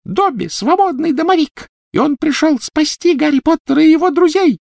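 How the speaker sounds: proud little squeak building to a cry; squeaky house-elf. A narrator's reading. Free elf proclaiming he came to save friends, squeaking. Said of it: "пропищал он в ответ".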